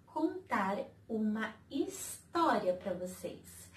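ordinary talk

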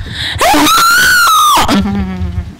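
screaming laugh. Real, not acted. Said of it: "I just scream into the mic".

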